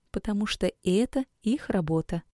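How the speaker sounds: ordinary delivery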